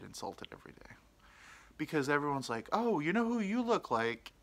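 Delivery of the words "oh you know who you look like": high-pitched mimicked stranger; imitated nosy stranger. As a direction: put on an eager stranger's high voice. Mimics people who insult him.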